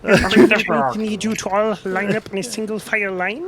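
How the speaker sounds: high puppet voice giving orders; comic Muppet-style commander; line them up in a silly high voice; Kermit. Acted. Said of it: "I would prefer to do Kermit".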